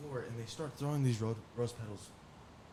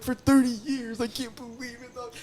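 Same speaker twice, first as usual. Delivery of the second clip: mock mourning; 13 semitones higher, 5 dB louder than usual. Inmates lament his sentence, high mock wail. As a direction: wail it in shared fake grief.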